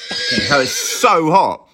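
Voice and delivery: talking through a hot mouthful; words round a hot bite; mouth full of hot food. Real, not acted. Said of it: "Literally burnt my mouth".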